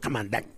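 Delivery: "Doc" rhyming with "back", tossed off low; faked slang pronunciation. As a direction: imitate a poser saying "Doc" as "dack". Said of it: "I don't fucking talk like that".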